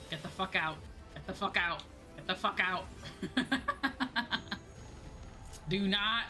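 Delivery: ordinary talk, nothing marked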